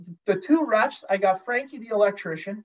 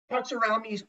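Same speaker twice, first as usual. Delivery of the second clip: usual speaking voice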